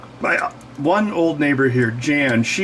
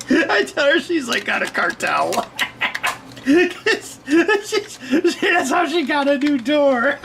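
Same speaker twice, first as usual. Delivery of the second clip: laughing; 13 semitones higher, slower than usual. Real, not acted. Laughing while teasing mom.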